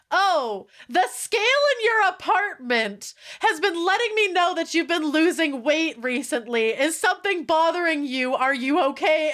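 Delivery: a hint of an overly caring boyfriend; voicing Caleb. Voicing Caleb's creepy phone call.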